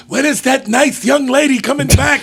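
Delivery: put-on a light old grandpa voice; old man voice. Grandpa's repeated question.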